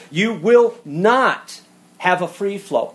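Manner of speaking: slow, loud, each word hit; slow emphatic warning. Acted. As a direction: hammer home each word slowly. Preacher hammers point, slow loud.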